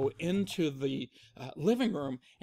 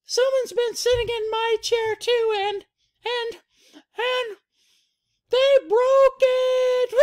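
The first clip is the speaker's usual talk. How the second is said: very high cub voice, building to a loud slow wail; tearful Baby Bear; 9 dB louder, slower than usual. Acted. Baby Bear with a broken chair, high mock crying. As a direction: stammer up high, then bawl. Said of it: "Baby Bear says".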